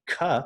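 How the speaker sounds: a crisp isolated "k." with a puff of air; the consonant "k." spoken clearly; k sound. Shown as a demonstration. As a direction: demonstrate the k sound alone. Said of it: "it's the k sound".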